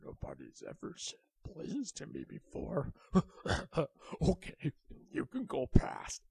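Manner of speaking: grin through the words. A narrator's reading. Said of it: "And the alligator said".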